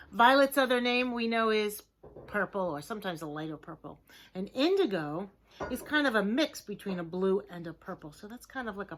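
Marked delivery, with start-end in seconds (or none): none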